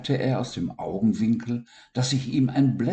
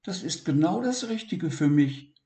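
high, wondering little voice; amazed; 10 semitones higher than usual. Acted. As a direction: gasp in delight. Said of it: "staunte Siggi".

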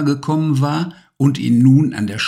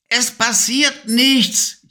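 whining witch; 13 semitones higher, slower than usual. Acted. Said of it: "jammerte sie".

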